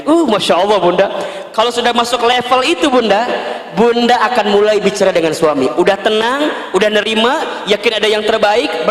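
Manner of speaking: ordinary delivery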